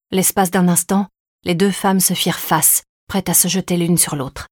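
usual speaking voice

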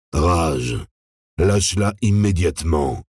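a hint of an unnaturally cavernous voice. A narrator's reading. Hybrid ordering Rage to let go, lightly cavernous. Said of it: "fit une nouvelle voix anormalement caverneuse".

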